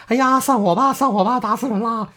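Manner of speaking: high wailing cry like a shrew; high, hammed-up shrewish howl. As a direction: wail it out like a shrew making a scene. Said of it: "跟个泼妇一样".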